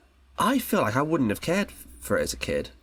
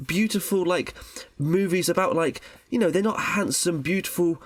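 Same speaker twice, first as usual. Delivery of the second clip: mock outrage. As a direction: play the indignant fan refusing to accept it. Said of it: "and I was like".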